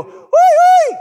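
high, loud whistle-like whee; shepherd's high whistle call. Retold or imitated. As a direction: call the flock with a piercing whee. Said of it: "one of them could go".

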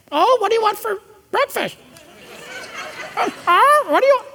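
high, mocking sing-song parent voice; parroting parent. Preacher mocks a parent who parrots his child, high sing-song.

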